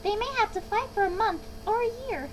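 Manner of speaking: high, querulous creature voice; Lorax's complaining voice. A narrator's reading. Lorax sending his swans away from smog, querulous. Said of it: "said the Lorax".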